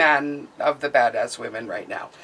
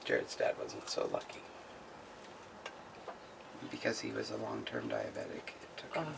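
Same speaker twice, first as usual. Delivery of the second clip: whispering sadly; 14 dB quieter than usual. Real, not acted. Telling of father-in-law's death.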